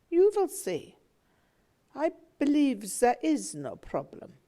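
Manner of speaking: smooth things over gently; mother soothing the father about their son; placid reassurance. Acted. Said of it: "my mother answering placidly".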